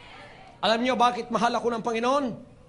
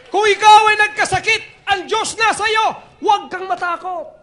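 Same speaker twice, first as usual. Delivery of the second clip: high, loud reassurance; loud exhortation; 9 semitones higher, 9 dB louder than usual. Real, not acted. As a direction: lift them with a strong call. Preacher exhorts loudly. (malakas na pagpapalakas ng loob)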